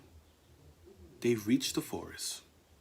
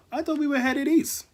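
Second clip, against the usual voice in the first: much higher, louder protest; high, loud cry; 13 semitones higher, 6 dB louder than usual. Acted. Lost animals crying out together in surprise, high and loud. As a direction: cry it out in shock.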